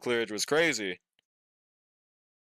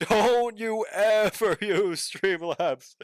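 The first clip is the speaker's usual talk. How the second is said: laughing warning; 7 semitones higher, 4 dB louder, much slower than usual. Real, not acted. Repeats warning louder, laughing.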